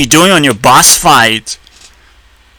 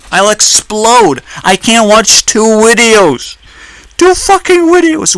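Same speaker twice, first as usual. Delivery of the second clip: slow, higher, half-joking fury; a hint of a hammed-up meltdown; mock rage; 4 semitones higher than usual. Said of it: "I am exploding in fucking rage fest".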